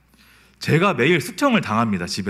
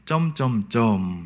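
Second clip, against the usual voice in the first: slow, drawn-out trailing dots; 3 semitones lower, much slower than usual. Retold or imitated. Dad pausing meaningfully before scolding, slow drawn-out trail. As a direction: stretch it out and let it hang. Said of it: "이렇게 합니다".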